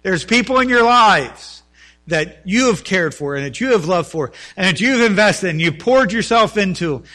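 loud, rising sermon delivery; fervent preaching. Acted. Preacher pleading with his church, loud and impassioned. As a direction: pastor urging the congregation, preaching loud and fervent.

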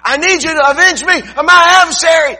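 high, loud nagging widow voice; pleading widow. Acted. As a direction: pester the judge like an insistent widow.

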